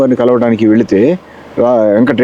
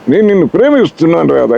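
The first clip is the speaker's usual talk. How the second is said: NTR love line; 4 semitones higher than usual. Shown as a demonstration. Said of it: "Ramarao గారు చెప్తే పెద్ద బాగుండదు".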